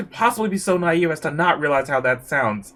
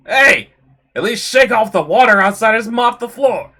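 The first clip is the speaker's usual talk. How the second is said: loud, raised-pitch telling-off; angry scolding; 4 semitones higher, 8 dB louder than usual. Acted. Scolding someone tracking water inside, loud and annoyed. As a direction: shout a complaint across the room.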